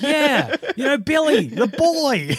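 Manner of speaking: high-pitched customer with a laugh in it; high mock customer. To coworkers, mimicking the movie customer, high and laughing. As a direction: lift it up high, laughing through the words. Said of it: "He's like".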